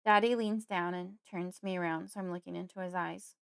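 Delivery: ordinary delivery, nothing marked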